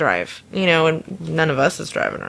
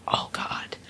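soft breathy whisper, much lower and quieter; whispered disgust; 11 dB quieter than usual. Retold or imitated. Coworker Caitlin catching the stench, whispered disgust. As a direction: hush it out in disgust. Caitlin smells the drunk lady.

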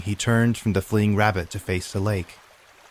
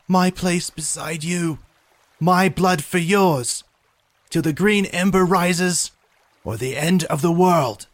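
battle cry shout; 10 semitones higher, 3 dB louder than usual. Acted. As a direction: call the oath across the water. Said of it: "and shouted across the water".

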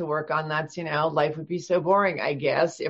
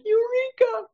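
mock eureka; slower than usual. Retelling her breakthrough to editor.

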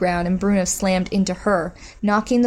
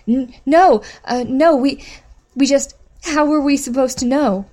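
frightened stammer; 6 semitones higher, 5 dB louder than usual. Acted. Terrified woman stammers reply.

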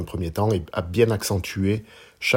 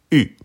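U short, loud, falling from high; fourth tone on U; 6 semitones higher, 8 dB louder than usual. Shown as a demonstration. Teacher showing learners Mandarin U, falling tone. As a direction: drop U sharply from the top. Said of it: "Quatrième ton".

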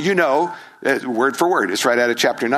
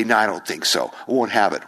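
flat refusal; 6 semitones lower than usual. Retold or imitated. Skeptics rejecting Jesus, low stubborn refusal. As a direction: shut it down coldly. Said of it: "others say".

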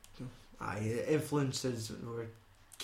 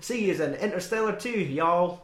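put-on southern accent; 5 semitones higher, 5 dB louder than usual. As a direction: laugh through a hammy cowboy farewell. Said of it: "That's enough butchery".

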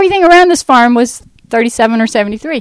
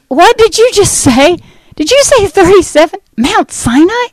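stunned disbelief; 9 semitones higher than usual. Retold or imitated. Stunned reply to friend's address.

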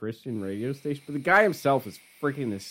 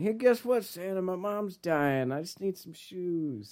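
mock sad kid; 6 semitones higher than usual. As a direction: whimper it like a sappy song kid. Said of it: "Oh, it's like".

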